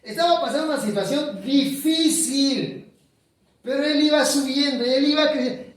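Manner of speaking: high, loud climactic sermon lines; fervent preaching. Acted. Preacher building the story to its climax, loud. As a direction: lift it to a peak of fervor.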